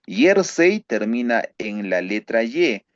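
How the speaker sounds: usual speaking voice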